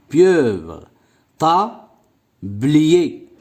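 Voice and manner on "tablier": "Tablier" said as ta, long pause, blier; split into syllables. Shown as a demonstration. Teacher showing pupils two syllables, word split in two. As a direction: pause clearly inside "Tablier" after ta. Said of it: "Deux syllabes aussi".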